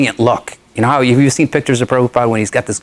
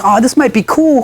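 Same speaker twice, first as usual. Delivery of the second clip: put-on eager girlfriend; 12 semitones higher than usual. Girlfriend coaxing him, retold.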